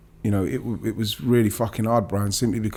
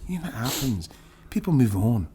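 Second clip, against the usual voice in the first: put-on Scottish accent. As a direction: imitate the Scottish boss in a hushed, gruff Scottish accent. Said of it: "he's doing his stuff with his Scottish accent".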